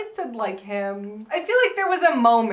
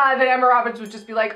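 ordinary delivery

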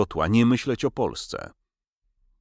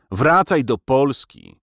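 slow, raised, scathing command; louder, higher and spiteful; venomous cry; 6 semitones higher, 6 dB louder, slower than usual. A narrator's reading. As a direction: cry it out with venom, drawing the words out. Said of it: "krzyknęła zjadliwie".

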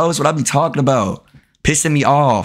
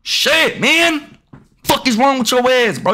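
angry shout; 9 semitones higher, slower than usual. Real, not acted. Gamer yelling at an opponent for reaching, angry shout. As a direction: explode at the reach, slowing on the first words.

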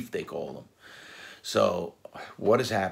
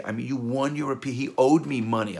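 usual speaking voice

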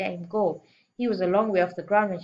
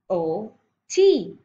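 plain reading voice

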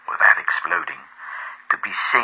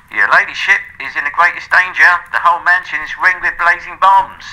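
high, loud alarmed warning; urgent servant's warning; 12 semitones higher, 6 dB louder than usual. A narrator's reading. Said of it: "The men about midnight rushed in".

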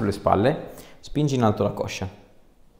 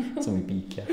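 said through a laugh; laughing quip; 2 semitones lower, 5 dB quieter, faster than usual. Real, not acted. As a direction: joke while still laughing. Laughing joke after cracking. (ridendo, scherzoso)

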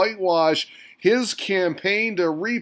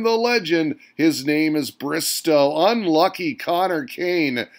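usual speaking voice

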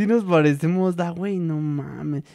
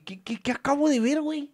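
retold disbelief; 11 semitones higher than usual. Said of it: "de que dije".

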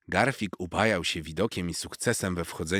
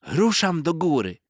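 high, loud eager cry; shout; 11 semitones higher, 7 dB louder than usual. A narrator's reading. Young cat shouting as he sets off up the tree. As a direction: call it out as the cat dashes for the tree. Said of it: "krzyknął".

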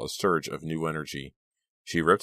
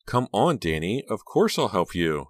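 ordinary delivery, nothing marked

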